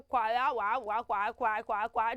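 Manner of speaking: a guessed wrong reading, "kwa, kwa", babbled; mock mispronunciation. Shown as a demonstration. Teacher trying wrong guesses for the children, mock mispronunciation. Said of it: "Do we say".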